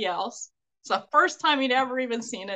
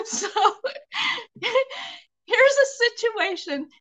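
high, slow words squeezed out through laughter; real laughter spilling into speech; laughing; 10 semitones higher, slower than usual. Real, not acted. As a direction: push the words out while still laughing. Still laughing at the story.

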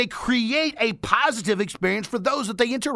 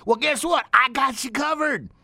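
reassuring; 6 semitones higher than usual. Real, not acted. Reassuring a shy listener.

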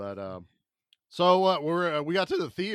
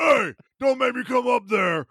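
loud high wrestler yell; Hulk Hogan shout; 8 semitones higher, 8 dB louder than usual. Retold or imitated. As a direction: bellow it like a wrestler. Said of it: "and Hulk Hogan jumps up".

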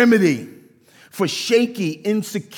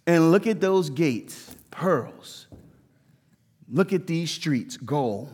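hushed awe; 4 semitones lower, 6 dB quieter, slower than usual. Pretend. Imagined John gazing at heaven.

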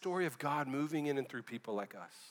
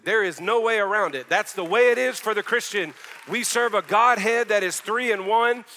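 loud, emphatic preacher's delivery; impassioned sermon declaration; full-force preaching; 5 semitones higher, 12 dB louder than usual. Real, not acted. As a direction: drive each statement home, building higher and louder.

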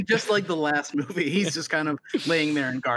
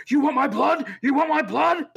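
high, loud, oddly inflected movie line; mock a weirdly delivered battle cry; Nick Cage; 11 semitones higher, 5 dB louder than usual. Host quotes the cave attack line, weird inflection. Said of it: "some weird inflection on a line".

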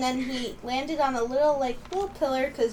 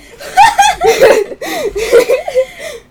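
loud high laugh; 15 dB louder than usual. Real, not acted. Big laugh at story.

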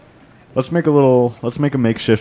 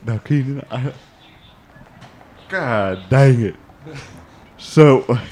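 ordinary talk

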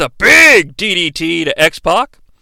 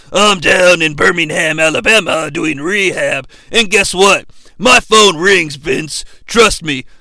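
Triple H impression; 3 semitones lower than usual. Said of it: "He's all like".

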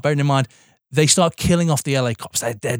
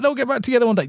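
higher, sing-song mock of a hopeful fan; 10 semitones higher, faster than usual. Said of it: "It's like".

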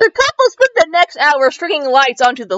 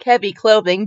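usual speaking voice

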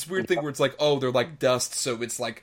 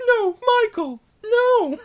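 loud falsetto, very high pitched; hammed-up man-as-woman shriek; awkward female voice; 7 dB louder, much slower than usual. Mocking the dubbed woman, awkward high female voice. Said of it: "doing the awkward female voice".

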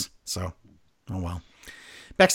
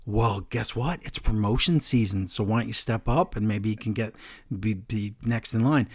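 low, soft, menacing; quiet Reigns impression; 5 semitones lower, 5 dB quieter than usual. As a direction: hush it like a quiet threat. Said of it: "he goes".

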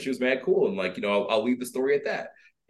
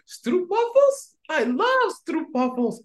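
high tiny squeaky voice; tiny high voice craving sweets; small voice; much slower than usual. Retold or imitated. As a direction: squeak it out like a little voice. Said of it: "a small voice go".

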